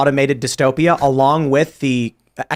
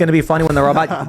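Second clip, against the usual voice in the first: ordinary talk, nothing marked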